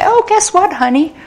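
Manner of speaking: high-pitched, excited imitation of an employee; imitated happy news. Employee comes home to tell spouse good news, excited imitation.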